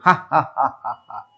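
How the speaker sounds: low, booming mock laughter; hearty elephant laugh. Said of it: "Chandu હસી પડ્યો".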